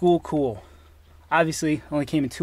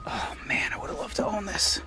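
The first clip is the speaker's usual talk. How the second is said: whispering; 4 semitones higher, 10 dB quieter than usual. Real, not acted. Whispered longing at engine.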